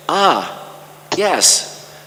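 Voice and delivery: ordinary delivery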